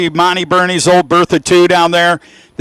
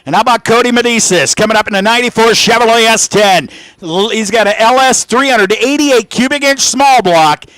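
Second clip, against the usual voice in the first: raised pitch, projected commentary; animated race announcer; 7 semitones higher, 4 dB louder than usual. Real, not acted. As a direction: sell the cars to the crowd.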